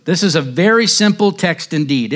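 ordinary talk

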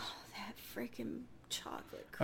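longing whisper. Real, not acted. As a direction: breathe it out with craving. Craving pie, whispered longingly.

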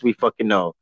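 ordinary talk

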